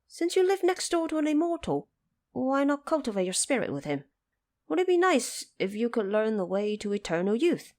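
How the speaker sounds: high, fast and insistent; eager Monkey King. Acted. Monkey King challenging the woodcutter, high and quick. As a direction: press the woodcutter like a cheeky monkey.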